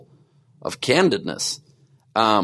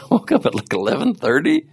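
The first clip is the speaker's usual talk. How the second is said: woke up said through a laugh, pitch jumping up; laughing admission; 9 semitones higher than usual. Real, not acted. Podcast host laughs telling listeners when he woke up. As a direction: laugh it out, a bit embarrassed. Admitting he slept late.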